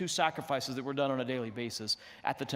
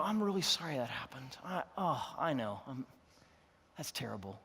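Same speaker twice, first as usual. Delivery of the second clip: put-on comforting a child; 5 dB quieter than usual. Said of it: "you get down and you go".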